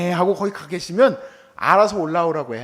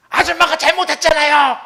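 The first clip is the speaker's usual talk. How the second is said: louder, higher blaming retort; mock angry accusation; 9 semitones higher, 7 dB louder than usual. Pastor blames the lady driver after a crash, loud. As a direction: snap back at the driver.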